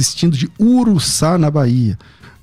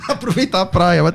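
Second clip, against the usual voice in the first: laughing; 5 semitones higher than usual. Real, not acted. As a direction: chuckle while speaking. Laughs during joke.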